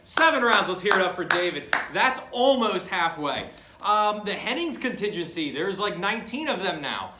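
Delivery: brash commentator. Acted. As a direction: punch it out like a hype commentator.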